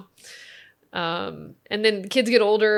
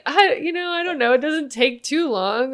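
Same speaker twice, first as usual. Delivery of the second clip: much higher, louder, fighting laughter; flustered laughing answer; 7 semitones higher, 6 dB louder than usual. Retold or imitated. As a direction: answer while trying not to crack up. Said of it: "I was like".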